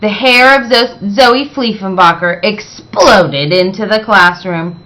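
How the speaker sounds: usual speaking voice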